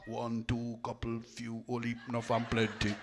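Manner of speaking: lightly voiced Jamaican counting. Shown as a demonstration. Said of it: "In Jamaica".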